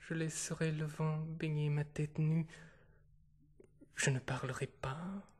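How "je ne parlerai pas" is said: plain reading voice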